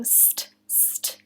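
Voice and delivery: "st, st" whispered, voiceless and soft; whispered st sound. Shown as a demonstration. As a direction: breathe out "st, st" like a secret sound. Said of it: "that's a consonant cluster too".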